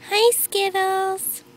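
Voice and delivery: high, loud, drawn-out greeting; calling a pet. Real, not acted. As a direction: sing out the pet's name excitedly.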